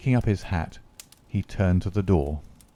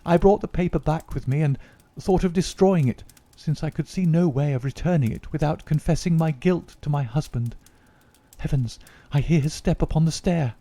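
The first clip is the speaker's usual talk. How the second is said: lady's voice pitched higher, worried; a fretful wife's raised voice; anxious lady; 8 semitones higher than usual. Acted. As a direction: confess it all as the frightened wife. Lady Hilda confessing to Holmes.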